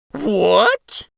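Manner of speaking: high, loud dismayed exclaim. A narrator's reading. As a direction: gasp out in dismay. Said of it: "exclaimed in dismay".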